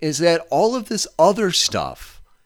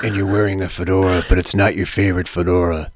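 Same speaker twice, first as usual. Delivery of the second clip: low gravelly dramatic narrator; deep, brooding movie-narrator rasp; Mickey Rourke gravelly voice; 7 semitones lower, faster than usual. Retold or imitated. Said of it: "Mickey Rourke's gravelly voice".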